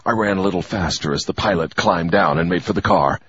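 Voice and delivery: usual speaking voice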